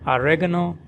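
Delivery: oregano as "a-REG-a-no", reg stressed; American oregano, REG stressed. Shown as a demonstration. Teacher contrasting oregano in American English, reg stressed. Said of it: "So in American English".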